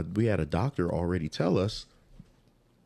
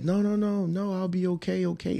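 high-pitched imitation of pleading wife; mock-pleading reassurance, high; insistent protest; 12 semitones higher than usual. Acts out wife insisting despite pain.

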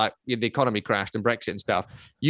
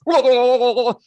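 loud, high trembling whoas; imitating a shaking drunk flatmate; shuddering whoas from a flatmate wrecked by Red Bull, high and loud; mock shaking; 10 semitones higher, 7 dB louder than usual. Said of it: "like, shaking".

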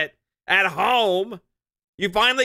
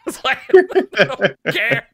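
said through a laugh, louder and slow; 3 semitones higher, 5 dB louder, slower than usual. Real, not acted. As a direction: laugh out the denial. Laughing while insisting indifference.

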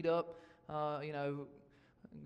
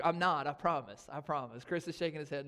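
usual speaking voice

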